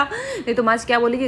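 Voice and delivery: ordinary talk, nothing marked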